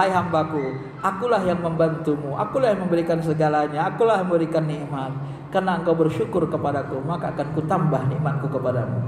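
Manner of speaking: low, calm divine reply; acted reassurance. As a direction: answer the faithful in a low, assured voice. God answering a grateful servant.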